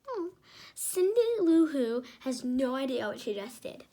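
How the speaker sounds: ordinary delivery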